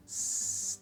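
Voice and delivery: "س" hissed without throat vibration; s sound. Shown as a demonstration. Teacher showing no throat vibration, "س" as a held hiss. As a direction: hold "س" as a long "sss". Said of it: "باعث لرزش گلوتون نشد".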